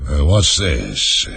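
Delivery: low, very slow, sleepy rumble; deep, drawn-out, drowsy lion voice; deep hollow lion. A narrator's reading. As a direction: toll it out like a great bell. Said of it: "speaking in a deep, hollow tone".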